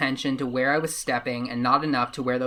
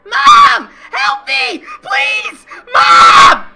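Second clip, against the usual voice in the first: high, piercing terrified scream; screaming for help; 17 dB louder, much slower than usual. Acted. Boy grabbed from behind, screaming for his mom. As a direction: shriek for your mom with everything you have. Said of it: "I screamed as loud as I could".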